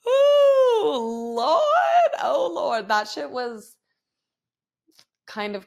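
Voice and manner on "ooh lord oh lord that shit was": much higher, louder whooping laugh; laughing exclamation. Real, not acted. Mom whooping with laughter after her story. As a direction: cry out Lord while laughing.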